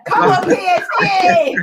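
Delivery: laughing slow high cheer for PSG; mock cheer. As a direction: cheer it through a laugh, hammed up.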